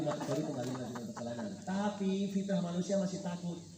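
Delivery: usual speaking voice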